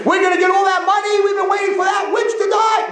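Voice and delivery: very high, crowing shout; hammed-up greedy jubilation; gleeful gloating. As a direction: crow it out at a high pitch. Relative gloats over inheritance.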